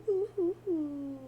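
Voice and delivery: high whimpering mock sob; mock sobbing. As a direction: sob in short high gasps. Mock crying, high pitched.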